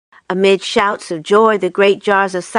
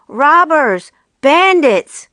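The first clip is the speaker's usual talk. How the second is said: shrieked; 6 semitones higher, slower than usual. A narrator's reading. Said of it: "shrieked Rat".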